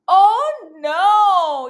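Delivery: alarmed gasp and cry. Acted. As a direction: gasp then loud oh no. Dragon makes more fire.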